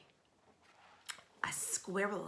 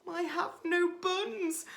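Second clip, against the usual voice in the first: high, small squirrel voice; high, trembling little squirrel; timid squirrel; 11 semitones higher, 5 dB louder than usual. Acted. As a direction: squeak it out nervously. Squirrel's frightened reply.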